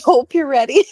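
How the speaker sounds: said through a laugh; laughing warning. Real, not acted. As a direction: break into laughter on "really". Laughs while warning the listeners.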